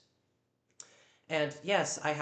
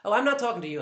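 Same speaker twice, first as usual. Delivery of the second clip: dismissive snap; 8 semitones higher, 10 dB louder, much faster than usual. Real, not acted. Dismissing housemate loudly.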